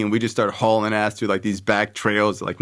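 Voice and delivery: usual speaking voice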